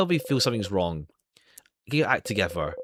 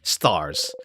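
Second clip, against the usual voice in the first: usual speaking voice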